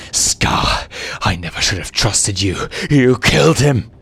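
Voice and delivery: rising into a loud furious accusation; growled. Acted. Simba turning on the villain, furious growl. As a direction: accuse him through bared fangs. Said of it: "Simba growled".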